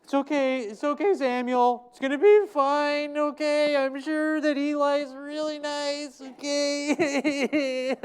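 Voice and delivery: high, cooing comfort, hammed up; mock comforting mother. Hannah reassures little Samuel, high mock cooing. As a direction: pat the boy on the head with your voice. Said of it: "pretend if I was Hannah".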